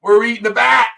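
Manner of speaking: mock loud, high exclamation; acted outburst. Retelling a shock at eating bat, acted loud outburst. Shocked reaction in retold story.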